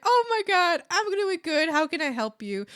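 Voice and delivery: high, loud, gushing reply; excited greeting. Retold or imitated. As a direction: re-enact a delighted server's welcome. Matches happy customer's greeting.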